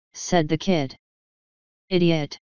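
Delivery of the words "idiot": plain reading voice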